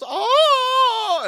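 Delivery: loud, high-pitched acted scream; mock horrified shriek. Game master acting a lady frightened by puppets, mock shriek. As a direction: shriek like a freaked-out shopkeeper. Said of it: "she just goes".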